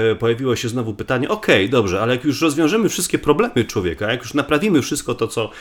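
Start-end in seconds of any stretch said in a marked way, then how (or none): none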